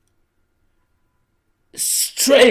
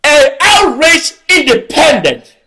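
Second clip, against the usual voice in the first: raised, higher, declaiming to the crowd; impassioned political oratory, pitched up; fiery rally speech; 5 semitones higher than usual. Real, not acted. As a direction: rouse the crowd, hammering each phrase. Rally speech at full force.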